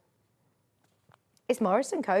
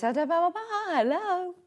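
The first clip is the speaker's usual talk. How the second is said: slow, drawn-out hello, like answering a landline; mock telephone voice of a granny; old-style phone greeting; 7 semitones higher than usual. Imitating granny answering the landline, drawn-out and slow. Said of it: "answer the phone by saying their phone number".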